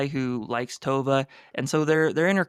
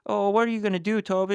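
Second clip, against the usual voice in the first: mock nitwit friends; 8 semitones higher, faster than usual. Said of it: "Her nitwit friends are like".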